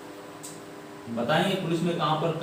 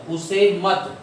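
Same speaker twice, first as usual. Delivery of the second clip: louder, higher "मत", then stop; pause after मत; 3 semitones higher, 4 dB louder, much slower than usual. Shown as a demonstration. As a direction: halt right after "मत". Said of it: "यहाँ पर रुके". ("मत" पर ज़ोर देकर रुकते हुए)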